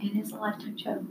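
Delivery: softly whispered agreement; hushed, knowing whisper; whispered echo. Real, not acted. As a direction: whisper it back, conspiratorially. Echoing in agreement.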